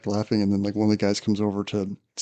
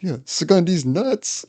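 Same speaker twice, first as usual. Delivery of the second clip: gleeful punchline; 8 semitones higher, 3 dB louder, slower than usual. Retold or imitated. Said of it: "Reagan's like".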